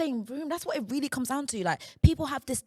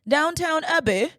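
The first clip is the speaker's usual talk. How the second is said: slow, loud posh English; showy period-drama posh English; posh English accent; 5 semitones higher, 6 dB louder, slower than usual. Acted. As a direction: stretch it out in a put-on posh accent. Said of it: "That was closer".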